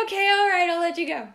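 high, loud mock-reluctant parent; mock giving in. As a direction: exaggerate letting go of your teenagers, loud and high. Mock giving in to her teenagers.

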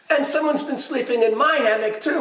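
high indignant outcry; high-pitched storybook protest; crying out. A narrator's reading. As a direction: cry it out like a pirate mama. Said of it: "Mama cried".

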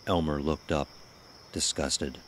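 ordinary delivery

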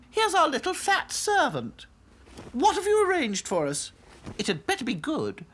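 high haughty doll voice; snooty little princess. A narrator's reading. Princess dolls.

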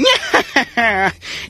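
high gleeful villain snicker; villainous giggle. Retold or imitated. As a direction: giggle like a scheming cartoon villain. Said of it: "twirling my mustache and going".

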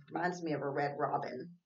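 ordinary delivery

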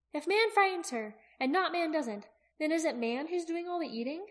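put-on high girl's voice, posing a puzzle; questioning young Aki. Aki listing open questions for Jaqen, high questioning voice.

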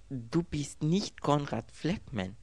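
slow, high-pitched disbelief; incredulous. A narrator's reading. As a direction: draw it out in stunned disbelief. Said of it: "Ungläubig starrte sie ihn an". (ungläubig)

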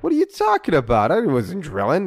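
high, loud mock protest; indignant denial. Tenant denying the drilling to his manager, indignant. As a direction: act out an outraged denial, pitch leaping up.

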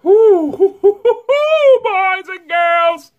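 high loud laughing hoo hoo, then a slow high call; laughing hoots. Real, not acted. Vlogger hooting at viewers from spicy heat, high and loud. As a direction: whoop through the pain and laugh. Spicy food burning mouth.

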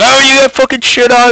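high, hammed-up crowd voice; mock everyone blowing the story up; exaggerated retelling. Players exaggerating the toilet paper story, hammed up and high. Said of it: "Everyone fucking exaggerated the story".

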